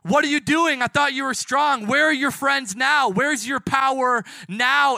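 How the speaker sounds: loud, high crowd taunts; yelling jeers. Acted. Crowd yelling at Jesus from the hill's bottom, jeering. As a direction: yell it up the hill in mockery. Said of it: "would be yelling".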